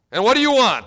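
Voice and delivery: loud, high annoyed snap. Acted. Busy pharmacist snapping at a little girl, loud and annoyed. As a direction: bark it out at the pestering girl. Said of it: "in an annoyed tone of voice".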